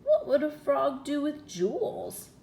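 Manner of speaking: high, loud puzzled frog voice; wondering frog. A narrator's reading. Frog asking the princess, high and puzzled. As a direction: ask it like a baffled little frog. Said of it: "he wondered".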